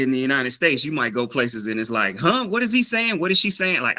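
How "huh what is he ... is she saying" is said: high-pitched, puzzled questioning; acted bafflement. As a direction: play a listener totally lost by the accent. Acts out confused listeners hearing unfamiliar speech.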